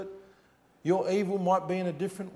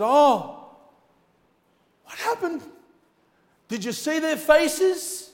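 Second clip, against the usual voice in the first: high, drawn-out mock astonishment; feigned shock; 10 semitones higher, 8 dB louder, slower than usual. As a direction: play the falsely innocent onlooker in a high voice. Acting the man who feigned innocence.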